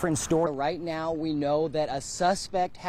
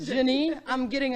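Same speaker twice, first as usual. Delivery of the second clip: Canadian French accent; 9 semitones higher than usual. Shown as a demonstration. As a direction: say Janie the way a Canadian French speaker would. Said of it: "I'm getting my Canadian French accent here".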